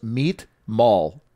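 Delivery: put-on clipped two-word text read out in a light Armenian accent; imitating Armin's Armenian accent. Retelling his Armenian friend's curt text, in a mild Armenian accent. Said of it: "In his accent".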